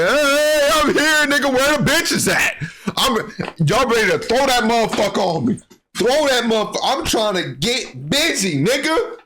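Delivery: high hype-man yelling; rowdy party crasher. Pretend. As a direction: burst in like a rowdy party guest. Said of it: "he didn't just crash it like".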